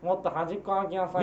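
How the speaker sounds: [name] impression. Shown as a demonstration. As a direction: lightly imitate a singer's haughty catchphrase, low and soft. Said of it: "[name]だよ".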